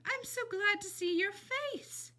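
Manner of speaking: put-on high, slow, sing-song old woman; old lady voice. A narrator's reading. Old lady welcoming Flora at the door, high and slow. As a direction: croon it like a sweet old lady. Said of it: "said the old lady".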